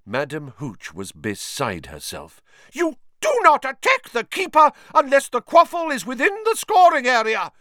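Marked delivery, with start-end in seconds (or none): 2.7-7.5 high piercing referee shriek. A narrator's reading. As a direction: shriek the rule out, outraged. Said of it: "she shrieked".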